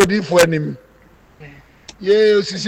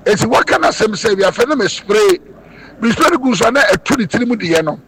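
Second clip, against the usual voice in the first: loud proclamation; 2 semitones higher, 6 dB louder than usual. Real, not acted. Prophet presenting his healing spray, loud proclamation. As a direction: proclaim it loudly to the congregation.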